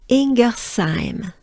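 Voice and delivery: "Ingersheim" slow, with an s in "saim"; French pronunciation. Shown as a demonstration. Teacher focusing on the French version, slowly. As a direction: draw out "Ingersheim" as een-guh-saim, lifting at the end. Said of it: "focus on the French one".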